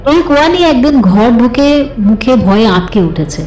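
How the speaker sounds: plain reading voice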